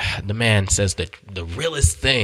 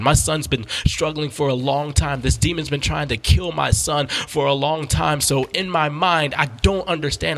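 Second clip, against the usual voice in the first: higher-pitched, impassioned testimony; excited preaching; 7 semitones higher than usual. Real, not acted. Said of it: "I'm getting excited".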